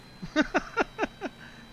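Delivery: breathless high giggle; nervous squealing laugh; high panicked laugh. Real, not acted. Plane goes haywire.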